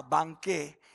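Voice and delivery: ordinary talk, nothing marked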